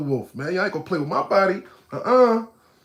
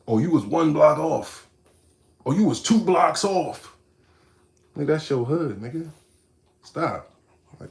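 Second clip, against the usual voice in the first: normal talking voice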